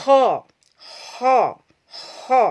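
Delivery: Arabic ho with tafkim; thick ho demo, loud. Shown as a demonstration. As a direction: push out a heavy tafkim ho.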